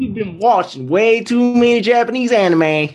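high-pitched mock Southern accent; put-on Southern character voice. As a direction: scold in a hammed-up Southern accent. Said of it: "Can you do the Southern accent?".

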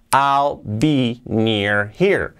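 very slow "I'll, be, near, here", H sounded; slow, words separated. Shown as a demonstration. Teacher before joining the words, slow and separated. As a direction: leave a gap after every word, keeping the H in "here". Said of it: "podemos hacerlo con esta palabra here".

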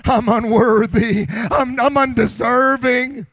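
from hushed to a loud anguished cry; confession building to a cry. Acted. The unworthy believer voiced for the church, rising to a cry. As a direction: climb to a shout on the last words. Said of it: "the more you realize".

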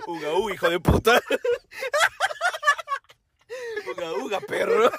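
high goofy caveman grunts; mock caveman uga uga. Playing cavemen together.

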